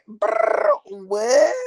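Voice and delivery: high, sharp "brr, what?"; reacting to dad writing a book, stunned; stunned disbelief. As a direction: caught completely off guard. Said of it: "And I said".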